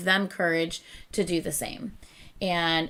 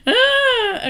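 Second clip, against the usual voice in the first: laugh-cry; 9 dB louder than usual. Real, not acted. Laughing while tearing up.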